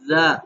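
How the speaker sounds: "زا" said thin and soft; a delicate, light "زا". Shown as a demonstration. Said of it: "کیسے جی؟".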